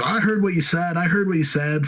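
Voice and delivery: high-pitched mock cop confrontation; insistent retold accusation, voiced high; accusing cop. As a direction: imitate an officer pouncing on a suspect, pitch jumped up. Acted cop grabbing and cuffing him.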